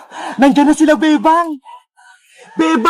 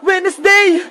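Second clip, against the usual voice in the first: usual speaking voice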